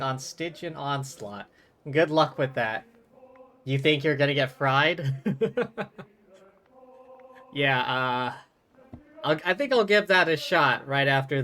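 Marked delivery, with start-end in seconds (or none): 5.2-6.0 a quick real laugh at chat; brief amused chuckle; short laugh. Real, not acted. Laughs at chat's question.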